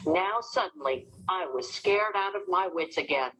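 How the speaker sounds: ordinary delivery, nothing marked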